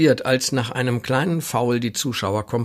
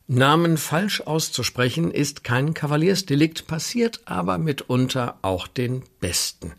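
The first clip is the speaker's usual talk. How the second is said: ordinary talk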